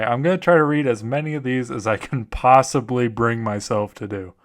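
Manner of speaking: ordinary delivery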